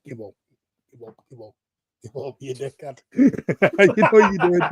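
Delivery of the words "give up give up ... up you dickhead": very quiet, low grumble; muttered jibe. Real, not acted. Panellist grumbling at a co-panellist who twisted his words, muttered. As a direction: mutter it under your breath, much softer.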